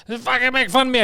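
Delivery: high-pitched mock complaint; imitated bullied nerd. Voicing the bullied nerd shooter.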